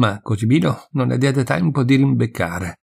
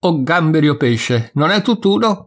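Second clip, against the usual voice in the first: high, raised-voice protest; exasperated shout; 6 semitones higher, 4 dB louder than usual. A narrator's reading. Sexton between servant and master, exasperated shout. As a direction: cut in, crying out at both. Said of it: "gridò allora Cosimino".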